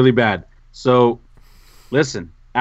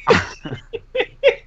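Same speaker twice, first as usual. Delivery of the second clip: high, helpless laugh; burst of laughter. Real, not acted. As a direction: crack up at your own story. Bursts out at the wrong name.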